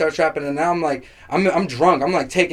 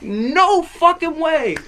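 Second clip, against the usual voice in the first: much higher, slow disbelief; 13 semitones higher, much slower than usual. Retold or imitated. Watching the girls fall for bad bars, slow and high. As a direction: drag it out in shock. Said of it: "I'm like".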